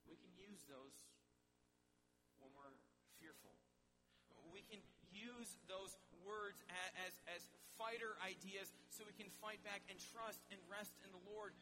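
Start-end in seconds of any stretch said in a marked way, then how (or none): none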